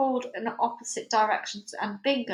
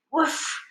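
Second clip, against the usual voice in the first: comic oof; 6 semitones higher than usual. Acted. Sheriff dives through window.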